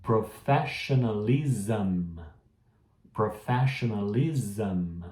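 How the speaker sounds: professionalism, x0.5, ending zam; slow teacher demo; slow model reading. Shown as a demonstration. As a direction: spell it out slowly for the class. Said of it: "repete depois de mim".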